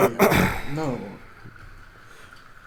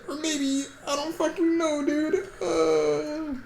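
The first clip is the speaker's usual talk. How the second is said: high, whiny helpless guessing; playfully wailed confusion; mock despair; 14 semitones higher, slower than usual. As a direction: rise into a much higher, near-crying whine. Mock despair while puzzling aloud.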